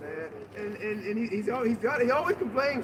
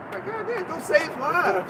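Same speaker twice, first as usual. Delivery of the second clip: mock exasperated outburst; 7 semitones higher, slower than usual.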